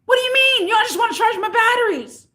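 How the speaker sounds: high, loud mock customer outburst; retold screaming. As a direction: yell it in outrage, imitating the customer. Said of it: "but you're yelling and screaming".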